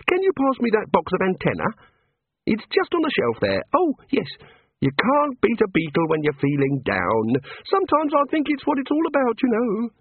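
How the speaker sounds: high, bright small-god voice; cheerful little god. A narrator's reading. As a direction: play an eager little craftsman god. God character voice.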